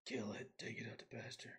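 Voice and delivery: hushed whisper. Pretend. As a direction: whisper it like a dark secret. Joking burial of old console.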